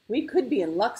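plucky front over hidden nerves; putting on bravery. A narrator's reading. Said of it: "sounding braver than he felt".

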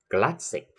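"classic" punched on its first syllable, CLAS; stress on CLAS. Shown as a demonstration. Teacher drilling -ic words, CLAS stressed in "classic". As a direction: hit the start of "classic", CLAS-sic. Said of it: "sẽ được nhấn âm ngay phía trước".

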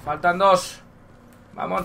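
ordinary talk, nothing marked

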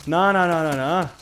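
high rapid frightened nah; scared refusal. Real, not acted. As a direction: refuse it in fear.

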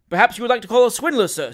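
pointed, provoking lawyer; goading. A narrator's reading. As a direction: bait him with brisk false courtesy. Lawyer goads opponent into slander.

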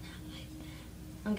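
slow soft whispered repeat. Pretend. Vlogger teasing viewers, soft whispered repeat. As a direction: breathe it again softly. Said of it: "I'm kidding".

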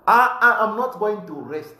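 loud, high declaration; fierce vow. Real, not acted. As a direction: shout your promise that you won't rest. Vowing to change Luapula.

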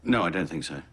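ordinary talk, nothing marked

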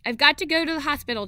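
urgent call to another room. A narrator's reading. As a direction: hurry it out across the house. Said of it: "she called out to Dad".

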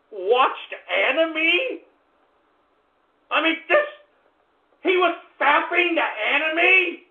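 high, slow disbelieving questions; incredulous. Real, not acted. Host reacts to released records on anime, incredulous. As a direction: draw it out in high-pitched disbelief.

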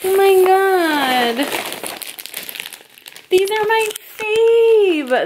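thrilled. Real, not acted. Opening a gift on camera.

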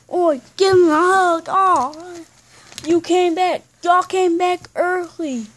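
high, excited welcome; delighted greeting. Pretend. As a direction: greet returning parents with play-acted delight. Child greets returning parents.

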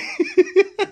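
short high giggle; delighted giggle; high laugh. Real, not acted. Laughing at baby.

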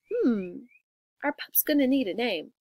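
usual speaking voice

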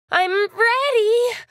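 high, stretched-out announcement; eager readiness. Acted. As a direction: draw it out with rising eagerness. Game character eager to race.

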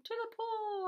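high, drawn-out echoing call; excited storybook yell. A narrator's reading. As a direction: holler it back, high and long. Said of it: "I yelled".

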